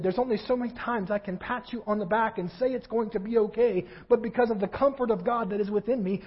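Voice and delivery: ordinary talk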